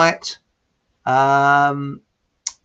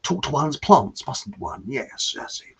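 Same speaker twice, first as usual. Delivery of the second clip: King Charles; slower than usual. Acted. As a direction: do a hushed royal impression, drawn out then trailing off.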